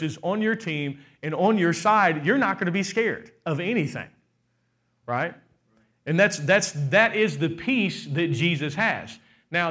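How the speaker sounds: usual speaking voice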